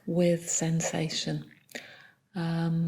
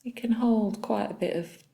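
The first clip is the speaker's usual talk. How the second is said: soft, unhurried body-scan guidance with long pauses; calm meditation leader, phrase by phrase; guided meditation; 6 dB quieter than usual. Real, not acted. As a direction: guide them gently through the body, leaving long silences.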